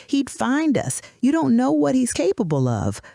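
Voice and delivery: high, fearful pleading; desperate. A narrator's reading. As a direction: plead in a high voice, afraid of him.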